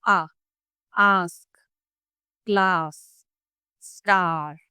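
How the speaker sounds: आ, आस्क, क्लास, स्टार with a long wide-open aa; teacher stretching the open back aa. Shown as a demonstration. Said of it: "long और open sound आती है".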